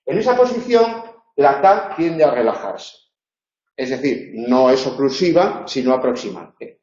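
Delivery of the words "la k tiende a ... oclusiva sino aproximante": normal talking voice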